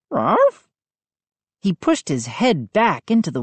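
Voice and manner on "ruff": short, high woof; questioning dog bark. A narrator's reading. Dog answering the boy's calls, questioning bark.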